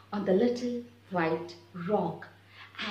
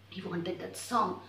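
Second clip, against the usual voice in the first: much softer, low; hushed; 4 semitones lower, 8 dB quieter than usual. A narrator's reading. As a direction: drop to near whisper. Quiet trailing narration.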